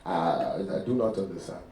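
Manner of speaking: put-on low, soft African-accented confusion. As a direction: feign not understanding in an African accent. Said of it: "I have a deep African accent".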